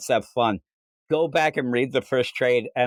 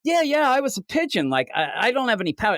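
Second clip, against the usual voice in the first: high-pitched Christina voice, much higher; acting out Christina; 10 semitones higher, faster than usual. Retelling Christina's denial, in a high put-on voice. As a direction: squeak out Christina's protest in a high voice. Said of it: "Christina's like".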